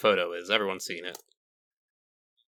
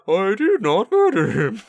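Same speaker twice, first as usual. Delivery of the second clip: bad impression voice; 9 semitones higher, 6 dB louder than usual. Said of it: "hear that voice being like".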